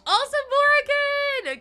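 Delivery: high, loud drawn-out Morgan; stretched call to a new follower; excited call. Real, not acted. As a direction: sing out the name. Calling out to viewer excitedly.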